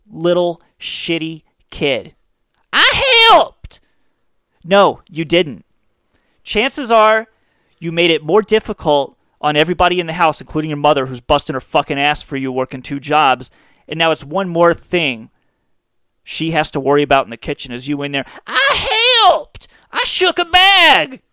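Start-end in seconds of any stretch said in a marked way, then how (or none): 2.7-3.8 put-on very high, loud little-kid squeal; bratty kid voice. Comic mocking the Shake and Bake kid, squeaky kid voice. 18.5-21.2 put-on very high, loud screechy kid yell; bratty kid shout. Kid shouting at his busy mother, high bratty yell.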